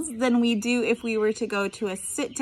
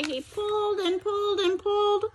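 ordinary delivery

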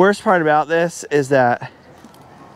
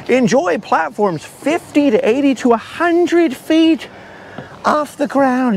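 mock pamphlet voice; 8 semitones higher, slower than usual. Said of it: "It was like".

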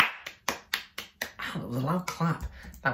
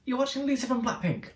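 higher, exasperated mock plea; pleading with the kids; 6 semitones higher than usual. Begging the bored kids on Lisa's behalf, exasperated plea. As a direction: beg them to be entertained, half joking. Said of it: "And I'm just like".

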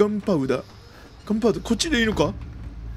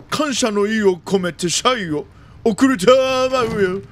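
ordinary talk

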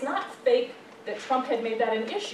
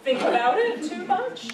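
words spilling out through real laughter; laughing; 3 semitones higher, 5 dB louder than usual. Real, not acted. Lecturer laughing with students over supermarket tabloids, laughing. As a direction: laugh through it at your own joke.

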